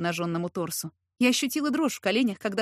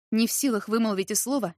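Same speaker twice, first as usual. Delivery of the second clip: plain reading voice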